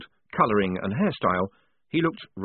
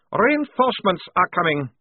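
high, loud snap; sharp, raised-voice officer; snapped; 9 semitones higher, 5 dB louder than usual. A narrator's reading. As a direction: snap it as a threat. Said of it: "he snapped".

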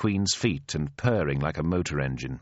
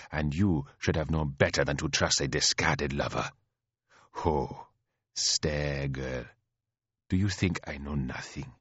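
low, soft, deliberate rebuke; composed stern queen; 4 semitones lower, 3 dB quieter than usual. Acted. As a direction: reprove the sister without raising the voice.

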